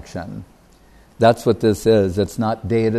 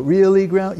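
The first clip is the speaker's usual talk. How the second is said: put-on eager child; 7 semitones higher than usual. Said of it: "they tell the child".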